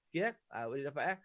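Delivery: usual speaking voice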